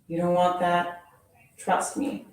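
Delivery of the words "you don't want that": ordinary delivery, nothing marked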